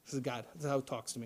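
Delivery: normal talking voice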